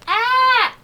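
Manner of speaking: put-on high, loud toddler squeals; toddler shouting.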